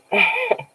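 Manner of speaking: high rising laugh; high real giggle. Real, not acted. Laughing on stream.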